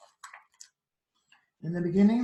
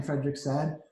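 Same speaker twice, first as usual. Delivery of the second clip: ordinary delivery, nothing marked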